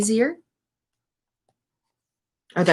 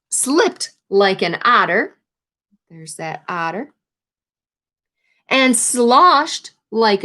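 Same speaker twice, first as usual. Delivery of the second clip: put-on reading to children. A narrator's reading. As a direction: bring the swimming to life for the kids. Reads picture book to class.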